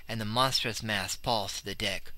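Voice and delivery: ordinary delivery, nothing marked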